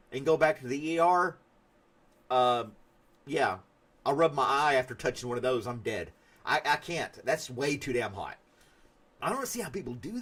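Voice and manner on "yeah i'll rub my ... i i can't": normal talking voice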